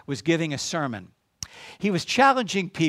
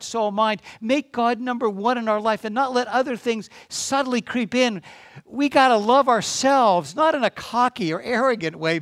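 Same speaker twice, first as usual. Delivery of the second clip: raised, driving sermon exhortation; impassioned preaching; 4 semitones higher than usual. Real, not acted. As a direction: urge the congregation on, pitched high and building.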